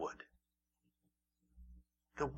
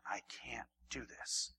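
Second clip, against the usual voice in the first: slow, very soft admission; soft confession; 2 semitones lower, 11 dB quieter, slower than usual. Acted. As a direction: confess it quietly. Humble confession voiced.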